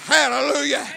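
sudden burst of worship; praise shout. Real, not acted. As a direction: cry it out in praise. Preacher breaks into praise.

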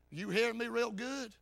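normal talking voice

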